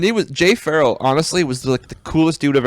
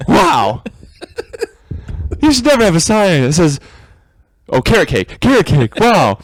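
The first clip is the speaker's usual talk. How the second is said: Isaac Whitty impression. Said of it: "Do your Isaac Whitty".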